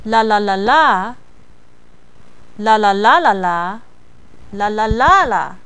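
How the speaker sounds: three separate "la" groups, each with a pitch peak; sentence melody on la. Shown as a demonstration. Teacher models sentence rhythm, "la" rising and dropping on stresses. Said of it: "raise and drop your voice in pitch".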